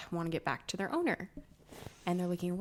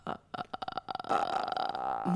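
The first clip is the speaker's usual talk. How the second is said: very low, creaky death rattle; horror-ghost vocal fry; The Grudge; much slower than usual. Pretend. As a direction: stutter out a ghostly croak in deep fry. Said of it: "a little like The Grudge".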